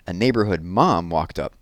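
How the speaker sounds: ordinary delivery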